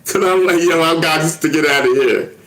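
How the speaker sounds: high, loud and urgent; worked-up, high-pitched re-enactment; frantic retelling. Retold or imitated. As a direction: raise the voice as if desperate to escape. Acting out frantic escape.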